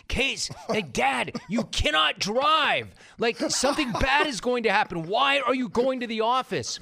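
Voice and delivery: high, loud, panicked plea; yelling at dad not to drive; frantic yelling. Retold or imitated. Retold panic at dad driving.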